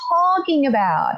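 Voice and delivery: high, incredulous retort; hammed-up bewildered protest; exaggerated disbelief. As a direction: act out being totally baffled, pitch way up. Retelling disbelief at skull remark.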